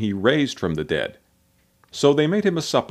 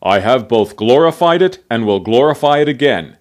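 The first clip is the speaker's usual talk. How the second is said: loud, booming proclamation at an even pitch; voice from the sky; 8 dB louder than usual. A narrator's reading. A voice out of the sky answering Jesus, proclaiming. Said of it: "came a voice out of the sky".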